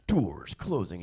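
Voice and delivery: imitated automated door announcement; train announcement. Train warning riders as it leaves, announcer imitation. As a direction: do the recorded train voice.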